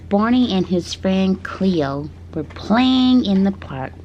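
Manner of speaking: usual speaking voice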